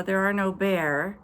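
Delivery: usual speaking voice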